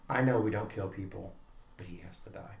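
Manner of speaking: low, soft, grave pronouncement; imitated solemn child. Daughter passing sentence on a game character, quiet and grave. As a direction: sink to a hushed deadly-serious toddler.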